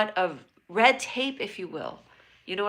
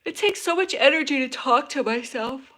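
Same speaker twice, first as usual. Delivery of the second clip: higher, louder put-on voice; 5 semitones higher, 5 dB louder than usual. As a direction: say it in a silly character voice. Odd put-on voice.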